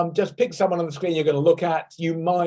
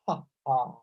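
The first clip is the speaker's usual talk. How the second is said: two soft breathy has, quiet; double ha breath; 5 semitones lower, 7 dB quieter than usual. Shown as a demonstration. Teacher shows the double ha to students, soft breathy has. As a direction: puff out two gentle has. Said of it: "breathe out with a double ha".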